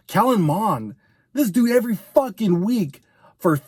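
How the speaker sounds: ordinary talk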